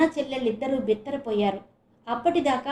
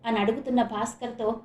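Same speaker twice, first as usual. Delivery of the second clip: plain reading voice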